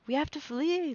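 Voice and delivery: high-pitched, pressing appeal; urgent plea. A narrator's reading. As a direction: plead urgently to get away. Lara urges mother to escape.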